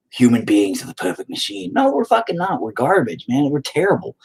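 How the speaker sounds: ordinary talk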